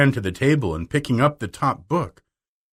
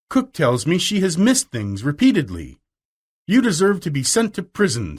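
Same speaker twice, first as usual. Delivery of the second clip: angry scolding; 6 semitones higher than usual. A narrator's reading. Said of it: "Miss Minchin's angry voice".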